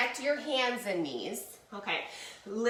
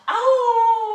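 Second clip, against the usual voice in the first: loud, high sustained howl; full-voiced imitation "awoo"; wolf howl; 12 semitones higher, 12 dB louder than usual. Shown as a demonstration. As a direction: let out a long wolf howl. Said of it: "Let's hear a howl now for the wolf".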